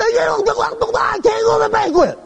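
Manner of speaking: put-on high, slow whining kid voice; whiny kid. Twelve-year-old whining to the preacher about the banquet, high and slow.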